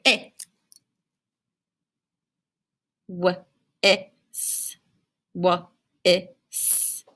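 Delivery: W, eh, s sounded out one sound at a time; sounding out letters. Shown as a demonstration. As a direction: stretch each sound of W, eh, s separately. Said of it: "So it's gonna say".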